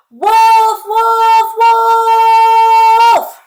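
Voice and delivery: full-voiced, high, drawn-out alarm call; yelling. Peter calls to the village from the treetop, yelling. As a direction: holler it long and high across the fields. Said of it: "he yells towards the village".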